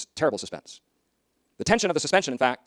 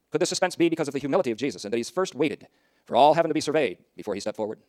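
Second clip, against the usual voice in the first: normal talking voice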